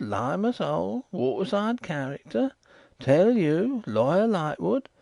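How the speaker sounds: each phrase beaten out, mild high insistence; slow and emphatic. A narrator's reading. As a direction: hammer every phrase like a sworn oath. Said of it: "slowly and emphatically, beating it all out".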